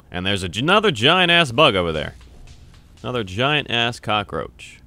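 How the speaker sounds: normal talking voice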